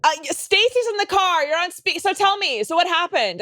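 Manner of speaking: high, slow oblivious friend voice; high and drawn-out oblivious caller; speakerphone friend. Retold or imitated. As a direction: play the clueless friend on speaker. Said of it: "And you're like".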